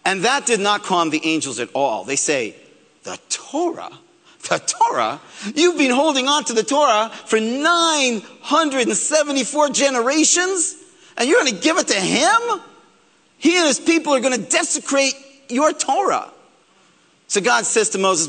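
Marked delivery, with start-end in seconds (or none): 3.0-3.9 incredulous. Acted. Angels shocked by Moses' request. 4.5-5.2 indignant repeat. Acted. Angels repeat it, falling. 5.5-16.3 higher, urgent protest from offended angels; indignant angels. Acted. Angels protest giving the Torah.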